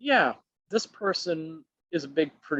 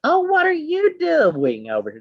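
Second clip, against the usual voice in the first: much higher, louder sneering put-on voice; snooty, condescending; 6 dB louder than usual. The old cheerleader finding him at the signing table, snooty and high. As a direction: lift the voice high and talk down to him. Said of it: "snooty little condescending attitude".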